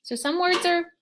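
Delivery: ordinary talk, nothing marked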